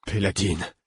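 lost, subdued call of a name; distraught. A narrator's reading. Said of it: "l'air éperdu".

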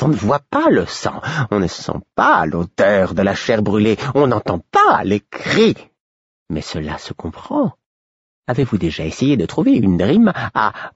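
high, mocking fool voice; bitter irony. A narrator's reading. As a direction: spit the joke bitterly. Fool's bitter ironic rant.

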